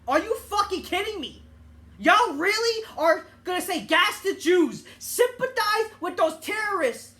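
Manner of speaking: loud, high, disbelieving yell; outraged shout. Real, not acted. Ranting at campus protesters, shouting in disbelief.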